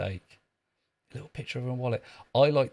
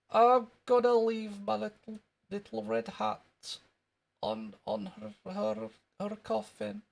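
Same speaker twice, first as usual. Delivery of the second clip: put-on high, halting Scottish boy voice; squeaky Scottish schoolkid, faltering over the words; Jimmy Krankie; 10 semitones higher than usual. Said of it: "That was wee Jimmy Cranky".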